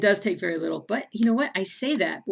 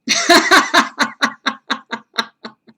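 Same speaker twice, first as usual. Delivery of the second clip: high loud burst of laughter; 9 semitones higher, 12 dB louder than usual. Real, not acted. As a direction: laugh out in disbelief. Loud laugh after shock.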